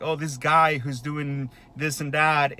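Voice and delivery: ordinary talk, nothing marked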